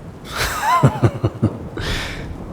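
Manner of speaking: breathy, derisive chuckle; amused villain laugh. Acted. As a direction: laugh at their bold defiance. Spirit amused by wearer's defiance.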